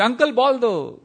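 high, pleading street-cricket call; kid calling out. Retold or imitated. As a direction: imitate a boy calling to an elder for the ball. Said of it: "You know, as a kid".

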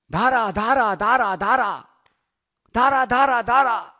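loud, high squawking parrot calls. Acted. The parrot on the tree calls down to Krishna, loud squawk. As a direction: screech it out like a bird. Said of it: "parrot goes".

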